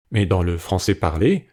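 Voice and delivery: ordinary talk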